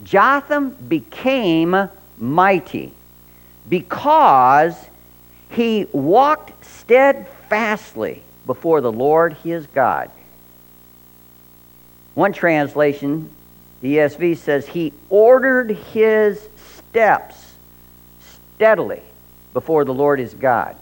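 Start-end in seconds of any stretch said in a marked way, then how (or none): 0.0-10.1 scripture reading. Acted. Scripture quoted slowly. 14.7-20.8 scripture reading. Acted. Scripture quoted slowly.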